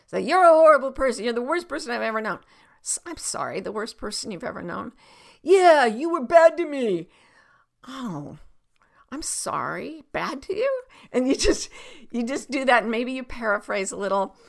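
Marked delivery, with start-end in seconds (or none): 0.2-2.4 fast, high acted outburst; acted accusation, starting loud; angry accuser. Acts out an accuser. 2.8-4.9 soft, gentle questioning apology; calm mirroring. Shown as a demonstration. 5.4-7.1 loud, high, drawn-out complaint; acted slow, loud whine; aggrieved accuser. 7.9-10.8 sympathetic, questioning reply; gentle mirroring. Shown as a demonstration.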